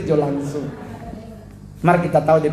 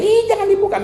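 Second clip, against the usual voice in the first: sudden much higher, louder alarm; panicked shriek; 4 dB louder than usual. Retold or imitated. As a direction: cry out to stop her. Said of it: "Kon dia baterai". (teriakan panik yang jauh lebih tinggi)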